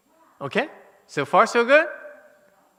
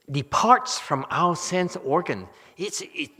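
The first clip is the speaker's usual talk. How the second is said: ordinary talk, nothing marked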